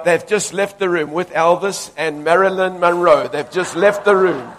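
loud, driving sermon cadence; fiery preaching. Real, not acted. Preacher driving out spirits of oppression, loud and fervent. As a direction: cast it out with full force.

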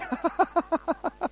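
high, rapid giggling laugh; real burst of high laughter. Real, not acted.

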